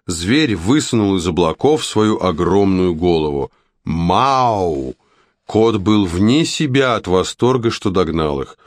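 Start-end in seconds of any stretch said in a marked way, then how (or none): 3.8-5.0 lightly voiced giant cat; a drawn-out narrator's miaow; cat's meow. A narrator's reading. The giant cat catches up with its prey, meowing.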